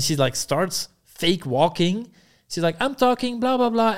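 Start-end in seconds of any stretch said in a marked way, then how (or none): 2.8-3.9 high, chattering mock Ukrainian accent; imitated Ukrainian accent. Man acting out her fake walking, high Ukrainian-accent imitation. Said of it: "with this Ukrainian accent".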